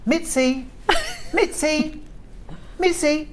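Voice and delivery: high calls across the room; calling the dog. Shown as a demonstration. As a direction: call the dog's name, high and drawn out. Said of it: "You ready for this?".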